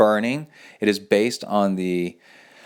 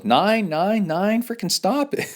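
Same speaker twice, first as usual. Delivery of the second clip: mock pain yell; 11 semitones higher than usual. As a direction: play a wimp begging to make the needle stop. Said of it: "somebody else might go".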